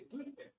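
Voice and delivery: "Twitter" as another mock variant, TWEE-ter; babbled English. Lecturer mimicking another announcer, "Twitter" in babbled English. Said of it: "veo esos locutores balbuceando".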